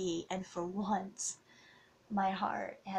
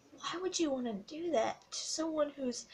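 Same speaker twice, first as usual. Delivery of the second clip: higher, voice straining with hurt; fighting back tears; emotional, near tears; 7 semitones higher than usual. Real, not acted. Vlogger recalling exes who hurt her, near tears. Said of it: "I'm getting a little bit emotional here".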